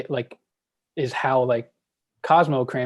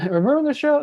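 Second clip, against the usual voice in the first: high-pitched mock complaint; exaggerated high-voiced grievance; Jerry; 14 semitones higher, 4 dB louder than usual. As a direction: whine it high, like a sitcom character. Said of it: "Jerry says".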